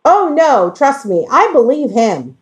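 ordinary talk

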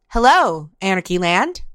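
usual speaking voice

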